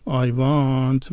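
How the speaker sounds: "I want" stretched out long and slow; drawn-out accented English. Shown as a demonstration. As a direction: drag out "I want" with a v sound. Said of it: "انقدر کشیده میگین". («I want» کشیده و آهسته)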